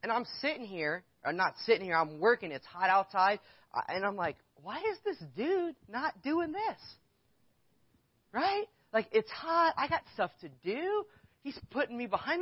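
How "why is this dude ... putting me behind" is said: exasperated inner voice. Retold or imitated. Young worker at Costco, annoyed at the customer's son, high exasperated whine. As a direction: whine to yourself about the lazy dude.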